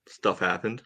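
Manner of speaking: normal talking voice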